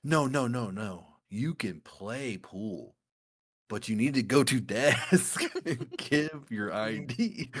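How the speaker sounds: very slow, drawn-out mimicry; exaggerated dragging words, breaking into a laugh; odd-talking bar guy. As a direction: repeat it like a stuck, strange bar guy. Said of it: "He's just like".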